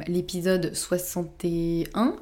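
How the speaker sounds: "soixante et un" drawn out slowly, rising at the end; unsure recall. Real, not acted. Host tries to remember an earlier episode's number, unsure recall. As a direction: search memory aloud, stretching "soixante et un" into a tentative rise.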